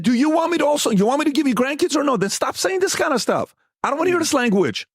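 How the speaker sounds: worked-up, high-pitched protest; retold argument. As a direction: reenact telling dad off. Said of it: "One of the biggest fights".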